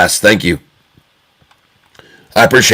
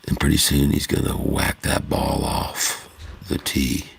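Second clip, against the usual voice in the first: hushed golf commentary, softer; 2 semitones lower, 6 dB quieter than usual. Pretend. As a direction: murmur the shot like a golf announcer on TV. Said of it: "I often do the golf voice".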